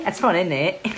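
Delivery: playful put-on British accent; mock British accent. Host trading accent jokes with guest, mock British accent. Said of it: "with a British accent".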